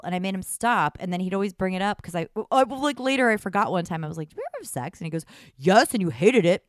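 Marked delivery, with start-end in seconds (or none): 4.3-5.0 own confused question. Retold or imitated. Retold quote of herself, confused. 5.6-6.7 put-on a light Italian accent, loud and flat; lightly voiced Italian lover saying it loud; lightly put-on Italian accent, a bit louder. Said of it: "with a beautiful Italian accent".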